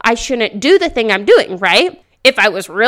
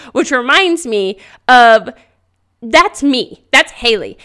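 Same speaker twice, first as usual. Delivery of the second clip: ordinary talk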